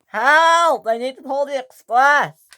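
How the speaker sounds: high, loud desperate yell; crashed engine yelling; calling for help. Acted. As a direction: shout for help in panic.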